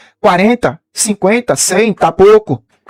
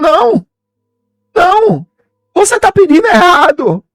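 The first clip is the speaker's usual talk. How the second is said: preaching at full force; 7 semitones higher than usual. Acted. Preacher crying out to the church at full force. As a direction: drive it home to the congregation.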